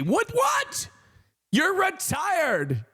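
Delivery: high squawk, then drawn-out complaint; mock disbelief. Retelling his reply to busy retired parents, mock disbelief. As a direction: squeal it incredulously, stretching the last words.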